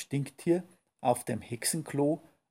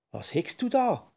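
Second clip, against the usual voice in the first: much higher, eager little creature voice; curious little skunk; 7 semitones higher than usual. Acted. Skunk asks the witch what she is brewing, high and curious. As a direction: ask like a nosy little skunk. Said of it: "fragte es neugierig".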